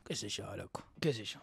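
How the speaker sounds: breathy half-voiced shrug of a reply; whisper. Real, not acted. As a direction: whisper it as an aside. Whispered aside.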